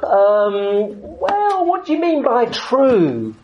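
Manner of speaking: put-on high, slow, evasive kid's voice; lightly mocking a clever child's answer; imitating his son. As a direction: play the sly ten-year-old dodging the question. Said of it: "was his response".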